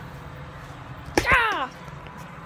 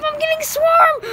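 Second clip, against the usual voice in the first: panicked high cry; 10 semitones higher than usual. Pretend. Kid playing a bee-swarmed character, yelling in mock panic. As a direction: shriek it like bees are everywhere.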